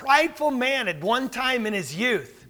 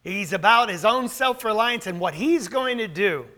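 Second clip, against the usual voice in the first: ordinary talk, nothing marked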